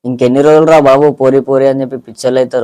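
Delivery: loud, swaggering dialogue delivery; punchy reel dialogue. Pretend. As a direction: belt the line like a film punch dialogue. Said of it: "ఒకసారి కొట్టా dialogue".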